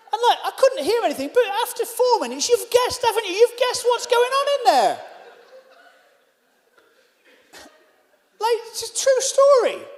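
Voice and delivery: very high, loud ranting; comic outrage. Acted. Guest telling audience what followed, comic outrage. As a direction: rant at the top of the voice.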